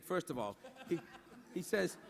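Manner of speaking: usual speaking voice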